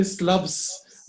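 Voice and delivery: SLABS as one squashed beat for "syllables"; wrong, clipped pronunciation. Shown as a demonstration. Teacher rejects a wrong version, said as one-beat SLABS. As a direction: crush the word into a single SLABS. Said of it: "No, no, no, no".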